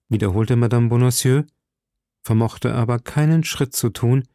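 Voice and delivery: plain reading voice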